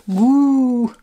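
mock-spooky ghost "booo"; playful ghost boo. Plays on shade name Boo. (bouh de fantôme pour rire)

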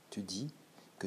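whispered, stunned; hushed whisper. Acted. Narrator reading Forestier's stunned reaction, whispered. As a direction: breathe the words out in shock.

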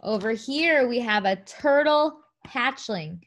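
usual speaking voice

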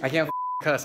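cuss cut off mid-word; bleeped out. Real, not acted. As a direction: stop the swear short. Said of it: "I have to bleep everything out".

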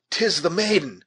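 high, loud exclamation; shout. A narrator's reading. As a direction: shout the discovery to companions. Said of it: "shouted the scout".

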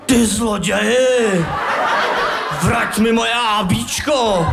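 mock angry yell, slowed; a half-hearted pretend shout; imagined shout at the thief. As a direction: yell it the way you wish you had. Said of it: "To jsem neřekl".

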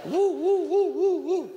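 high, loud laughing woo woo cries; mimicked holy laughter whoops. Imitating his wife laughing on the floor, high laughing whoops.

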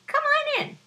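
high squeaky little fly; a tiny insect inviting guests. Acted.

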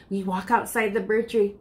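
plain reading voice

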